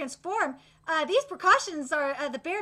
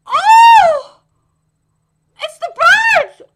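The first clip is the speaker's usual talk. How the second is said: a far higher, much louder startled cry; shocked cry; 10 semitones higher, 16 dB louder, much slower than usual. Acted. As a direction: scream as the birds arrive. Shock as birds swarm.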